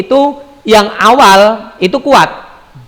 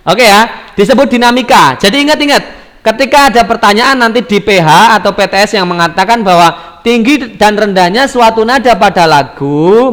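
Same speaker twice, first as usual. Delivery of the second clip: normal talking voice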